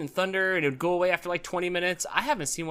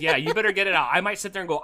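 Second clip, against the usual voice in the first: normal talking voice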